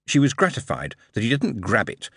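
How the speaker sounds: ordinary delivery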